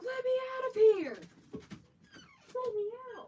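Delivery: high pleading dog voice; whiny dog voice. Retold or imitated. Owner voicing her dog begging to go outside, high whine. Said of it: "He's like".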